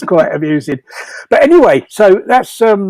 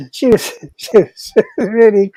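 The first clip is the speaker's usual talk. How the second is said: laughing; 5 semitones higher than usual. Real, not acted. Laughing while describing her friend.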